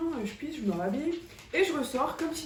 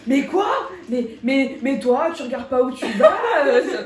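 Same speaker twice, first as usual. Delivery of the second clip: mother scolding; 8 dB louder than usual. Retold or imitated. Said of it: "maman qui regarde papa".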